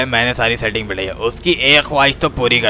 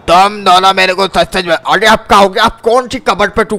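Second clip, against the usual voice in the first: angry confrontation; 6 semitones higher, 5 dB louder than usual. Acted. Confronting the two angrily.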